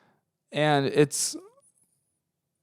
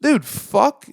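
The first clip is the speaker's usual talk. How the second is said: much higher, louder exasperated cry; exasperated outburst; 12 semitones higher, 7 dB louder than usual. Real, not acted. Speaker venting at the guy who called them tired, loud and exasperated. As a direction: blurt it out in frustration.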